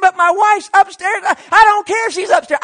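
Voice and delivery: high, loud back-and-forth yelling; heated shouting match. Retold or imitated. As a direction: argue at the top of your voice.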